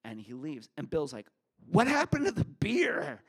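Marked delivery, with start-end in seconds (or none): 1.7-3.2 much higher, louder startled friend; Bill, amazed. Retold or imitated. Friend Bill asking where the beer went, astonished. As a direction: squeak it out in disbelief.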